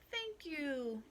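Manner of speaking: high cry gliding down; grateful cry. A narrator's reading. Freed leprechaun to old Pat, crying out thanks. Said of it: "cried the leprechaun".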